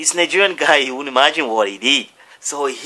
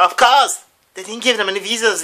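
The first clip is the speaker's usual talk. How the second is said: high, emphatic protest; acted indignant retort; 9 semitones higher, 4 dB louder than usual. Man answering school staff about refused visas, acted indignation. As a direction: hit back as the aggrieved party.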